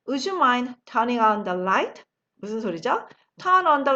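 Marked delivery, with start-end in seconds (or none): 0.1-2.0 slow, clear question to the class; slow model question. Shown as a demonstration. Teacher asking the class a slow practice question. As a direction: ask it slowly for learners. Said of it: "제가 질문을 해볼게요".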